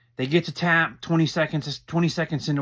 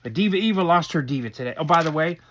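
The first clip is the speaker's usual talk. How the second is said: ordinary talk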